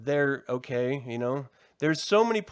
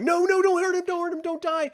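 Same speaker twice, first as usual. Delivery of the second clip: mock pleading; 14 semitones higher, 3 dB louder than usual. Pleading for the Rancor's life.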